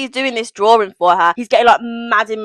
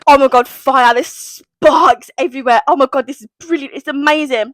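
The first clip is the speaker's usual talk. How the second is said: raised, loud mock rapture; gushing excitement; 5 semitones higher, 6 dB louder than usual. Reader acting out the sparks of the kiss, gushing. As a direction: gush as if fireworks are going off.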